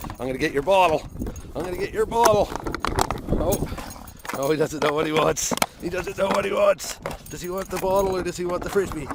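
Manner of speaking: high, loud singsong teasing; playful teasing dog voice. Real, not acted. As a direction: tease the dog over the bottle.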